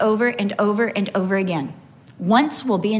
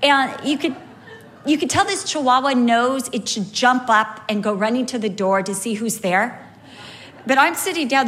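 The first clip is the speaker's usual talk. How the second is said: normal talking voice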